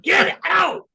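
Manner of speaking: high, loud comic roar; imitated blustering yell; Ralph Kramden bellow. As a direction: throw them out with a hammed-up sitcom shout. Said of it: "Like Ralph Kramden".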